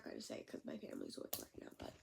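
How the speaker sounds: quick hushed whisper so family can't hear. Real, not acted. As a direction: whisper it fast, keep it secret. Hiding it from family nearby.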